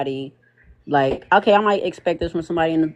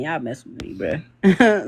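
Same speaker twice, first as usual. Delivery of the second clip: ordinary delivery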